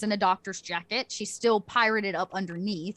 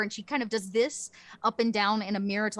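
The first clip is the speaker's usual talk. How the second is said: ordinary talk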